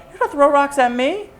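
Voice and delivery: high, loud mock outrage; retold angry challenge. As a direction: re-enact an incredulous challenge to the kids. Acted threat back at rock-throwing kids.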